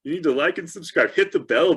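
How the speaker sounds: YouTube voice. Pretend. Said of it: "put on my best YouTube voice".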